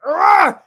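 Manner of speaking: loud, high grunting roar; roaring shove. Acted. Acting out the man pushing Ellis back, loud roar.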